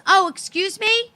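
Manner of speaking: put-on high, innocent kid asking a favour; imitating young girl. Young girl at the bottom asking the mother, imitated high and innocent. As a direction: mimic a child politely asking.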